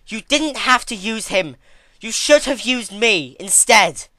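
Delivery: grieving sister screaming at her mentor; angry scream. A narrator's reading. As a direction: shriek it out in fury. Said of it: "she screamed angrily".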